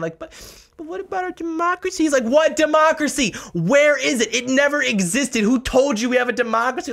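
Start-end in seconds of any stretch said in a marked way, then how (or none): 0.2-2.0 much higher whiny mock voice; whimpering. Mocking opponents to viewers, whimpering in a high childish voice. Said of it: "They're, like, whimpering". 2.2-6.9 mock retort. Mock retort in quoted exchange.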